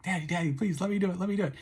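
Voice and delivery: high pleading child's voice, imitating his daughter; little daughter begging her dad to let her remove the bandage, high and pleading. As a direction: beg like an eager little girl. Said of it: "she was like".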